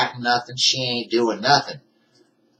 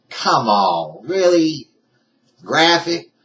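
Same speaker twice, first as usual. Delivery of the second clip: incredulous; 3 semitones higher, 5 dB louder, slower than usual. Real, not acted. Disbelief at YouTube's graphic rule.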